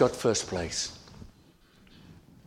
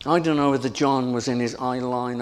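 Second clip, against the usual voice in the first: ordinary talk, nothing marked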